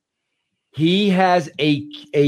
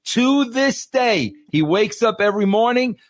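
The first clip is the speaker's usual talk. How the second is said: high, insistent exclamation; emphatic amazement; 7 semitones higher than usual. Real, not acted. Marvels at Seinfeld's daily discipline.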